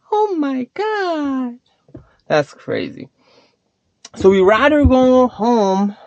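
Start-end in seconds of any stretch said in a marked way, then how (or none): none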